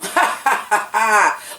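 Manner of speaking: loud open cackle; burst of laughter. Real, not acted. Fan recapping a catty joke, cracking up. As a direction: crack up at your own retelling.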